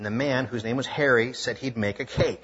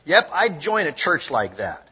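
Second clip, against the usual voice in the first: lightly mocking, louder scoff; 4 semitones higher, 6 dB louder than usual. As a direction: scoff at the idea, half teasing. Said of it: "in a mocking way said".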